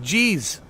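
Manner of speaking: Jeez said like the word cheese. Shown as a demonstration. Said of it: "Like cheese".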